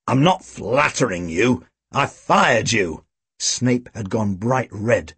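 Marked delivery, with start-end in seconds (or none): 0.1-3.0 slow, emphatic correction; exasperated police chief. A narrator's reading. Chief reminding Tim he fired him, exasperated. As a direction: spell it out to an idiot.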